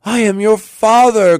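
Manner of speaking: high, loud impassioned cry. A narrator's reading. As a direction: proclaim it to a long-lost daughter. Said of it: "cried he".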